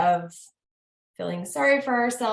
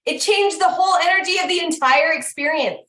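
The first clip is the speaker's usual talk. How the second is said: excited; 9 semitones higher, 7 dB louder than usual. Real, not acted. Excited payoff of her story.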